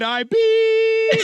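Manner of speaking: high, sustained "beep" imitation; censor bleep. Telling co-hosts the rest is censored, mimicked bleep. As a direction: bleep it out loudly on one held note.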